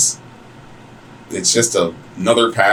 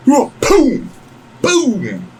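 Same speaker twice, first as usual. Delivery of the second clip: high, booming "boom" for a fall; high-pitched comic impact noise; imitated crash. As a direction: punch out a cartoon thud.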